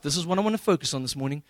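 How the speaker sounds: ordinary delivery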